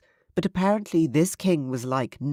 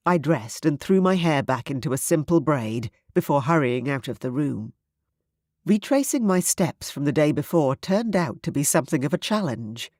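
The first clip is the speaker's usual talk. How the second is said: plain reading voice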